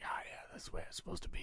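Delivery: quick, whispered aside; very soft, under the breath; hushed satisfaction. Retold or imitated. Quoting own reaction to the trailer.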